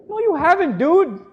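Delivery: high, loud comic rebuttal; incredulous retort. Acted. Preacher answering a man who claims he read it, incredulous retort. Mock retort to the quoted boaster.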